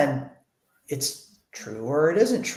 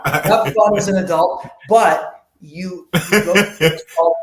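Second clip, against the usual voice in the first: 1 laughing joke; 4 semitones higher, 7 dB louder than usual. Real, not acted. Joking about adult circumcision.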